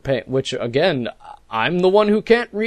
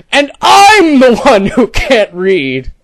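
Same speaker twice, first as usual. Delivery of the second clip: louder, slow, indignant sarcastic read; mocking sarcasm; 3 semitones higher, 4 dB louder, slower than usual. Reading the troll's indignant line aloud, mock sarcasm. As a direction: drag it out in mock outrage.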